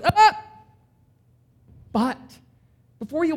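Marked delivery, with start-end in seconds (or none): 0.0-0.4 high loud cry of ah; sudden call out. Retold or imitated. Scholar calling after departing Jesus, high loud. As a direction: call him back urgently. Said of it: "And the guy goes".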